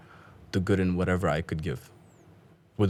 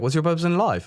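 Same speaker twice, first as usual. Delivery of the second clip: high insistent mock of the man; a guest mimics a pushy man's question; pushy repeated question; 9 semitones higher, 4 dB louder than usual. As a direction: press the question on him, high and loud.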